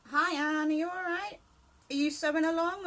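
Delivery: high, loud cheerful hello to a viewer; bright greeting. Real, not acted. Greeting a live chat viewer by name, high and loud. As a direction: call out a happy hello.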